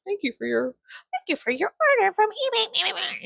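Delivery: high, bright robotic readout of a shop message; high sing-song phone message voice; chirpy automated voice. Retold or imitated. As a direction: read it like a cheery app notification. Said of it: "I see on my phone".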